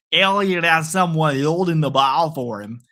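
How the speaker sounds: drawn-out, hammed-up Canadian accent; slow mock-Canadian play-by-play. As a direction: stretch the vowels like a put-on Canadian announcer. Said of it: "I sound like a Canadian here".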